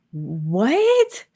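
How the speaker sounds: retold shock, drawn into a question; incredulous. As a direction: blurt it out in disbelief. Retelling shock at being banned.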